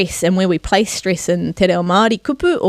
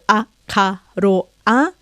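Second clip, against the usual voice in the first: a, ka, ro, a, each vowel separate; syllable by syllable. Shown as a demonstration. Teacher counting syllables, separated beats on a, ka, ro, a. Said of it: "we count them".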